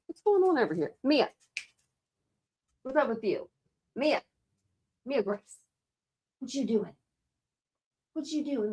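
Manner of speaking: gentle scolding voice for a puppy; pet talk. Real, not acted. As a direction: coo at the pet, way up high and hushed. Talking to the pets.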